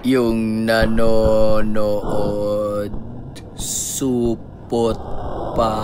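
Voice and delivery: dragged-out, very slow and low slow-mo voice; slow-motion voice. Pretend. Gamer teasing the viewers, slow-motion voice. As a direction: stretch each word like a slowed recording. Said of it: "Ba't ka nag-sound ng slow mo?".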